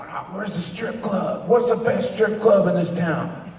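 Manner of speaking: put-on high, drawn-out drunken hollering; obnoxious drunk. Retelling a fan's strip club question, mock drunk. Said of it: "drunk, going".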